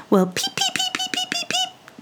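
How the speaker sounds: very high, soft peeps; tiny birds chirping for food; chirping baby birds. Acted. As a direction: chirp like little chicks. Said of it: "chirped all the little baby birds".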